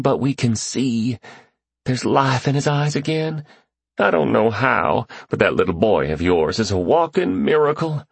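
tearful wonder at a miracle; choked with joy. Acted. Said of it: "His voice was choked but exhilarated".

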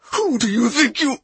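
furious, high and cut off; angry outburst. A narrator's reading. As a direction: snarl it before lunging. Man lunges in fury, cut off.

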